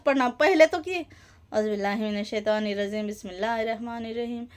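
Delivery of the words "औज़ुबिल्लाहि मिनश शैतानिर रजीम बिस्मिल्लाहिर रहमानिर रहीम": lower, softer, unhurried Quran recitation; slow calm recitation. Shown as a demonstration. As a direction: recite each word slowly and peacefully. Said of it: "इस तरह धीमे से". (धीमे, तसल्ली से, नीची और धीमी आवाज़ में तिलावत)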